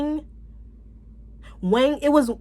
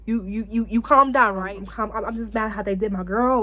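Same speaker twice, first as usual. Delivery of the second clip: ordinary talk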